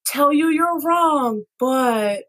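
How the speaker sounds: high, slow, careful apology. Shown as a demonstration. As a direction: tiptoe around it so nobody takes offence. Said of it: "Really inoffensive, clearly not attacking".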